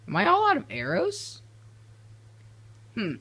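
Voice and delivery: squeaky, very high questioning voice; high-pitched puzzlement. Real, not acted. Builder whose dispenser will not fire, high puzzled squeak. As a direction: ask it up high, in disbelief.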